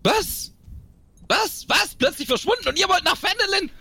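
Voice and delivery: high-pitched, aghast questioning; shocked disbelief. Acted. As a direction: demand answers in rising alarm, voice jumping high. In character, learns brother has vanished. (entsetzt und ungläubig, mit hoher Stimme)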